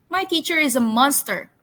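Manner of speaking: playful, brisk accusation; mock accusation. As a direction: call it out like an accusing pupil. Title repeated as accusation, denied next.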